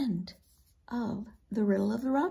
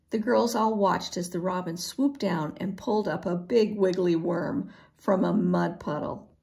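ordinary delivery

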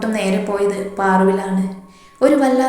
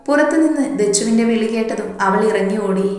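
plain reading voice

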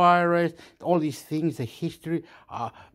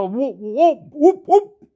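rising, much higher and louder whoops; whooping yells; 10 semitones higher, 9 dB louder, slower than usual. Real, not acted. Gamer whooping louder and higher at the screen. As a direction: whoop it up, building each time.

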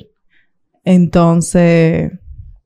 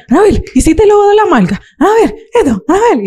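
high, quick scolding mother voice; pitched-up naggy mom impression; imitating mom; 11 semitones higher than usual. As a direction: mimic a nagging mom checking your work. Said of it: "Ella, ella es".

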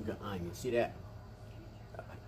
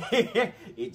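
laughing; 10 semitones higher, 8 dB louder than usual. Real, not acted. Laughing about own fast talk.

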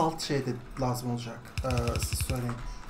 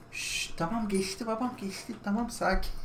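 yumuşak, tiz ve sakinleştirici; kuşu usulca sakinleştir; yatıştırıcı; 5 semitones higher, 4 dB quieter than usual. Real, not acted. (kuşu yumuşak sesle yatıştırma)

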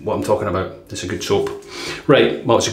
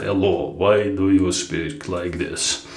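slow, lower mock Russian speaker; slow put-on Russian voice; mock Russian accent; 3 semitones lower, slower than usual. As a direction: drawl it out like a Russian. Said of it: "everyone speaks Russian, they slow down".

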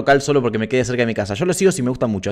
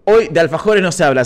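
ordinary talk

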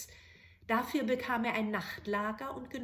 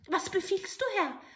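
high, eager little goblin voice; bow and ask for orders; little grey man; 10 semitones higher than usual. Acted. Grey manikin appearing to the soldier, eager.

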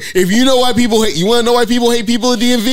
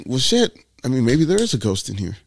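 hushed to himself; 7 semitones lower, 6 dB quieter than usual. Retold or imitated. Retold nervous thought late night.

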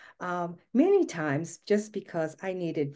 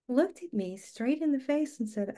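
ordinary talk

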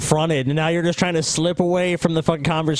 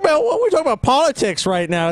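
mocking Tommy's dodge; 8 semitones higher than usual. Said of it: "Just like".

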